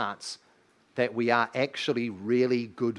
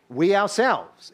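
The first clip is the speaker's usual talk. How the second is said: "We ourselves" slow and louder, higher; emphatic; 4 semitones higher, 4 dB louder, slower than usual. Shown as a demonstration. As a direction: drag out "We ourselves" with weight. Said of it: "It's emphatic".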